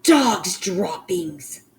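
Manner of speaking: slow, drawn-out loud yell. Acted. Witch announces the smell, yelling slowly. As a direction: stretch it out in disgust, loud. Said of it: "she yelled".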